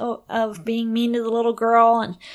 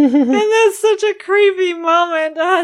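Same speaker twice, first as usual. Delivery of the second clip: laughing; 7 semitones higher, 7 dB louder than usual. Real, not acted. Laughing at the creepy story.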